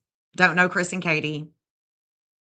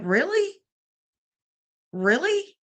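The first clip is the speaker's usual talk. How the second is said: incredulous really; 9 semitones higher, slower than usual. Retold or imitated. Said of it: "it's just like".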